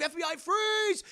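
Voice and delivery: mock law-enforcement shout; shouted command. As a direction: yell it like agents bursting in. FBI agents burst in, acted.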